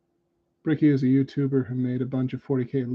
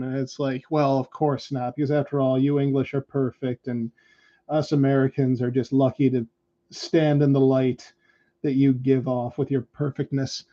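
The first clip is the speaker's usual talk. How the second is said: normal talking voice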